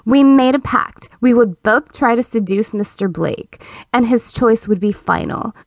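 usual speaking voice

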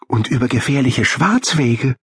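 high-pitched little animal voice; girl hamster friend. A narrator's reading. Molly adds to the list of dangers, high chiming voice. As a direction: chime in with a high voice.

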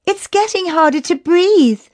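put-on high girlish complaint, a touch winded; panting. A narrator's reading. Chrissy sinks down to rest, mildly panting. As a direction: complain as if short of air. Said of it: "panting as she plonked down".

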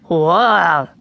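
low, drawn-out gorilla call; gorilla grunt. A narrator's reading. Boy enjoying dad's peas, low gorilla noise.

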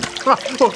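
high, jeering cackle; taunting laugh. Acted. Man jeering at Mulligan, high taunting laugh. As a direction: laugh at his misfortune, pitched high.

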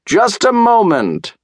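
high, drawn-out call to someone below. A narrator's reading. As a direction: sing out high and slow. Doctor calls down to fox.

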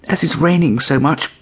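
pleading kitten. A narrator's reading. Kitten begging to stay out of the rain.

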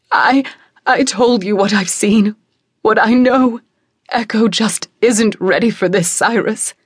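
loud, upset insistence; distraught mother. Acted.

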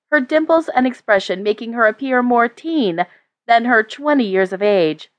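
plain reading voice

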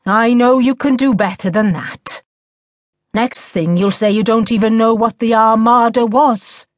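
lightly voiced weary schoolmaster, pressing the pupil; teacher chiding a pupil; exasperated teacher. A narrator's reading.

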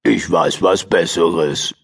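low, sly crocodile with a throat clear; smug low reptile idea, then clear the throat; grinning crocodile. A narrator's reading. Crocodile Rick with a sly plan, low and grinning. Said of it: "grinste Rick".

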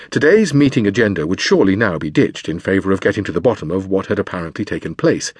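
ordinary delivery, nothing marked

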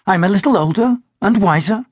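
a gentle self-aware jest; Glynis's voice. Acted. Glynis replies to Cameron.

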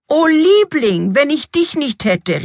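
high, gushing outburst; effusive exclamation. A narrator's reading. King thanks his wife for reminding him, effusive cry. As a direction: start drawn out and high, full of gratitude. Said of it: "rief er".

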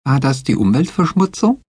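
put-on high, small girlish voice, downcast; high, timid girl asking sadly; dejected Betsy. A narrator's reading. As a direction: ask it in Betsy's high, worried voice. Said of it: "fragte Betsy bedrückt".

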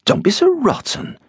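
sudden high, prim scold; scolding busybody. A narrator's reading. Sister-in-law scolding Ben on the coach, high and prim. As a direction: squeal it up in mock offence.

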